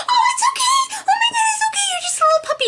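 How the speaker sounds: put-on very high baby-talk coo with a laugh; cooing to a puppy. Soothing a struggling puppy.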